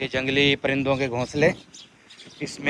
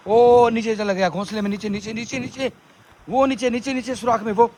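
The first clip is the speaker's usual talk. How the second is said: high, eager pointing out; excited; 8 semitones higher, 4 dB louder than usual. Real, not acted. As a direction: call it out as it darts away. Spotting bird escaping into nest.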